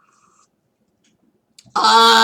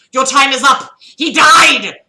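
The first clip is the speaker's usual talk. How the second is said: loud, higher doom voice; ominous loud voice; 7 semitones higher, 12 dB louder than usual. Acted. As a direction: announce their doom loudly.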